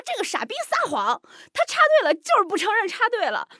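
very high, loud indignant outburst; hammed-up high-pitched tattling; retold angry accusation. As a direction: re-enact complaining furiously to a mediator. Re-enacting heated accusation after fight.

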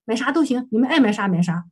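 usual speaking voice

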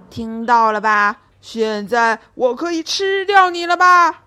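lion turns on Minus, fierce and loud. Acted. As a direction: threaten 现在我可以吃掉你了吧 fiercely. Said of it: "凶狠地说道".

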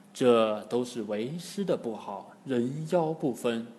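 low, soft apology; ashamed. A narrator's reading. Master apologising to his disciples, low ashamed voice. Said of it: "唐僧很羞愧".

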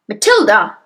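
loud, accusing call; angry, forceful shout; roaring. A narrator's reading. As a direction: roar the name at a culprit. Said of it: "she roared".

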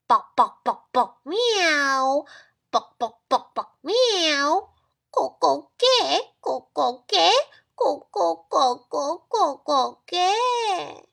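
loud clucks and meows, slowing at the end; helpless animal noises. Acted. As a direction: rooster pretending to be a cat. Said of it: "无助的叫声". (无助地学猫叫)

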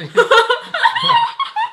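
a sudden high burst of laughter; laughing at the joke. Real, not acted. Laughs at his own joke.

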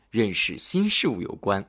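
ordinary delivery

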